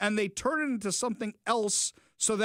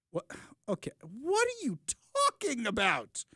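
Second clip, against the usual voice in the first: incredulous; 6 semitones higher than usual. Real, not acted. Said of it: "reacting to fascism quote".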